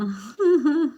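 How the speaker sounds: high, loud burst of laughter; sudden loud amused laugh. Real, not acted. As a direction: laugh out at the nickname. Greeting a viewer with a laugh.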